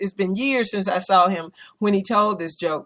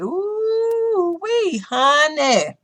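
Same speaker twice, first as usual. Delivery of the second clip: high, stretched-out ooh wee honey, slow; drawn-out mocking squeal; mock exclamation; 8 semitones higher, much slower than usual. Mimicking the caught offender, high and slow.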